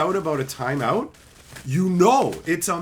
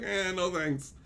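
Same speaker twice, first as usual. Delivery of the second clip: soft, offhand refusal; dismissive mumble; 9 dB quieter, slower than usual. Acted. Turning down interacting with kids, quiet dismissal. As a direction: brush it off under your breath.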